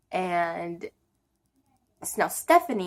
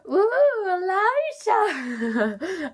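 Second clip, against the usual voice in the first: high, drawn-out teasing coo; teasing mom; 12 semitones higher, much slower than usual. Retold or imitated. Mom hears about the boy at school, teasing singsong. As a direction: imitate a mom swooning over her daughter's crush.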